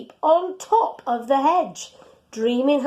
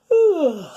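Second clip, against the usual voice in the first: yawn; 6 semitones higher, 6 dB louder than usual. Real, not acted. Narrator yawns mid-title.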